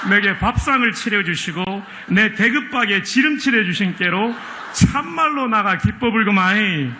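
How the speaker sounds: put-on a Jeolla old-timer bursting with joy; Jeolla dialect. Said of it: "전라도 버전입니다". (전라도 사투리로 흥겹게)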